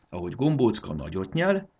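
usual speaking voice

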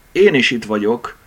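ordinary delivery

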